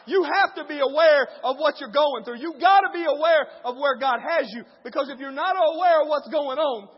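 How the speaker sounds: loud, shouted sermon climb; full-force preaching. Acted. Pastor calling the church to grace, shouting. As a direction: preach it hard to the crowd.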